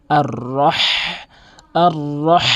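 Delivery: "আর রহ" ending in a long strong h from mid-throat; throaty h. Shown as a demonstration. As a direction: roll the r of "আর রহ", then push h from the throat. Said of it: "হাটি কিন্তু হলকের মাঝখান থেকে". (গলার মাঝখান থেকে হা দিয়ে "আর রহ")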